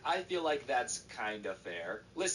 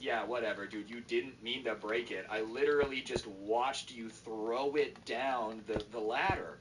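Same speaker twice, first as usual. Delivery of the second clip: plain reading voice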